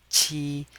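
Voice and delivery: qi high and level; first tone. Shown as a demonstration. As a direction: hold "qi" flat on a high note. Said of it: "with the first tone".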